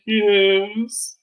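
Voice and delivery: high, whiny fake-tearful answer; mock crying. Brittany tearfully accepting a hug, mocked crying. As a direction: stretch it into a put-on tearful whine. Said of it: "just cried".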